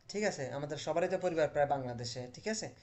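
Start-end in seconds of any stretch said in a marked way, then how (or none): none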